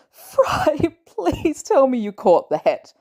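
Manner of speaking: wheezing laughter through the words, slow; laughing till tears come; laughing breathlessly. A narrator's reading. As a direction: laugh so hard you can barely get it out. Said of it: "could barely breathe and speak".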